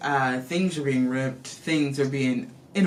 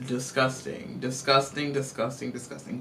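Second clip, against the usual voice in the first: fading disgust; 4 semitones lower, 7 dB quieter than usual. Real, not acted. Repeated, fading away.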